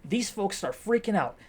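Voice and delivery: ordinary delivery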